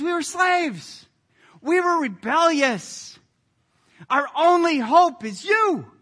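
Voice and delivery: loud, high-pitched cry to God; impassioned plea. Acted. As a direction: cry out fervently, leaning hard on key words.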